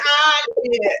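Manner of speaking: usual speaking voice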